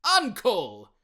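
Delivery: loud, high plea; pleading. Acted. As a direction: beg him to stop. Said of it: "pleaded the nephew".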